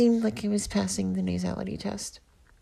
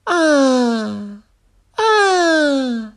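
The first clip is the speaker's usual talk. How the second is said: loud, open sung-out ah, rising higher on the second; open quotient exercise ah; 5 semitones higher, 10 dB louder than usual. Shown as a demonstration. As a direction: let a big open ah ring out, then again higher. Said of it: "So first I'm gonna do the open quotient exercise".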